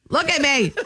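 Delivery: ordinary delivery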